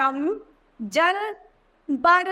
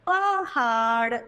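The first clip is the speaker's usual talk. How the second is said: पहाड़ stretched slowly syllable by syllable; slow syllable reading; much slower than usual. Shown as a demonstration. Teacher modelling पहाड़ slowly. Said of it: "इन उदाहरण को पढ़ते हैं हम".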